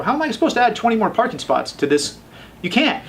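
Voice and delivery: high, disbelieving protest; incredulous. Retold or imitated. He protests the parking demand to the city, incredulous.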